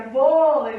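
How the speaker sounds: VÔLEI with a loud, long VÔ; vôlei, first syllable punched and stretched; stress on first syllable. Shown as a demonstration. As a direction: lean hard on the VÔ of vôlei. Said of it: "A sílaba tônica é o vô".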